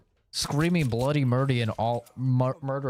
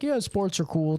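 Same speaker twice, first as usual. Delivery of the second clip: breezy, higher voice of a casual fan; casual sports fan; 7 semitones higher than usual. Retold or imitated. Streamer mimics a casual sports fan, breezy and higher. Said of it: "a casual, like".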